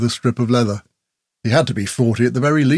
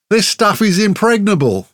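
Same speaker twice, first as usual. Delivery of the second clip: boastful; 9 semitones higher than usual. A narrator's reading. Grell boasting of his gear.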